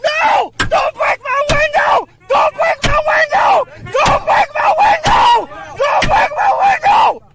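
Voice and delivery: desperate yelling, high and loud; panicked shouting. Real, not acted. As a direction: scream it in panic. Man panics as police break window.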